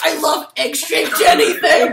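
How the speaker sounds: loud, high, slow squeal of laughter; laughing excitedly. Retold or imitated. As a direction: gush it while cracking up. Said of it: "You're like".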